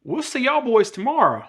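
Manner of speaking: high, sweet fake-polite voice; mock phone guy. To the cussing caller on the phone, sugary fake-polite voice. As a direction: play nice to the caller while seething. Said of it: "Y'all done sent us down here".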